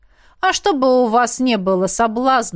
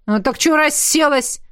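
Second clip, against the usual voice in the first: raised, higher-pitched scolding; calling out; 7 semitones higher, 3 dB louder than usual. Acted. Coach scolding a trainee sitting down, calling out. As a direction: snap into an impatient call. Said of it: "выкрикнул он".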